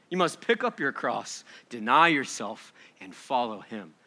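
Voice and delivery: usual speaking voice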